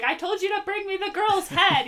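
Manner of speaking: high-pitched mock scolding; put-on scolding of a hopeless henchman; exasperated villainess. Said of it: "she'd be like".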